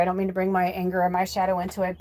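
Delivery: usual speaking voice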